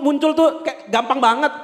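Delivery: ordinary talk, nothing marked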